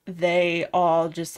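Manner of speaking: ordinary talk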